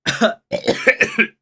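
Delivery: harsh, loud coughing; coughing fit. Real, not acted. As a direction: cough hard after the drink. Choking on the rum.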